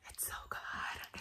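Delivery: breathy whispered praise, slow. Real, not acted. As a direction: whisper it slowly like a secret.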